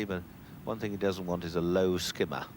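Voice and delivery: ordinary delivery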